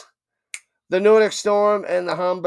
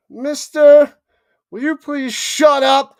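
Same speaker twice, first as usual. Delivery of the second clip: louder, higher shout of annoyance; loud outburst; 7 semitones higher, 7 dB louder, slower than usual. Real, not acted. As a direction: yell at him to stop talking. Snapping at someone mid-stream.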